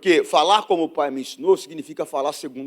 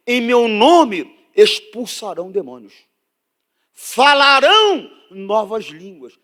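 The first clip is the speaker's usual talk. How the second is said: verse building to a loud, slow, raised cry; scripture proclaimed; 6 semitones higher, 7 dB louder, slower than usual. Real, not acted. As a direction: build force and stretch the key words. (citação bíblica proclamada, crescendo em força)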